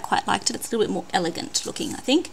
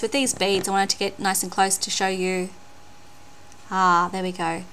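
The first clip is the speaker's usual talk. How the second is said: ordinary talk, nothing marked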